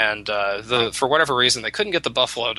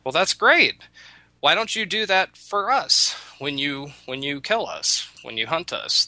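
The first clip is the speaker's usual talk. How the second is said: mock cheerful buffaloes, pitched up; buffaloes' high voice; 6 semitones higher than usual. Buffaloes to the chief, mock bright high proposal. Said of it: "And the buffaloes say".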